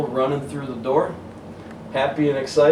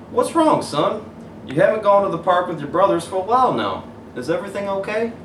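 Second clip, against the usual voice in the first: higher, gentle fatherly concern; caring dad voice; 9 semitones higher, 3 dB louder than usual. Acted. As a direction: ask like a worried dad.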